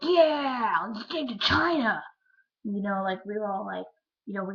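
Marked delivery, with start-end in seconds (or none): 0.0-2.0 put-on high, loud, gung-ho kid cheer; eager kindergartner yell, imitated; excited kid. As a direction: shout it like a kindergartner with a big plan. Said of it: "And I was like".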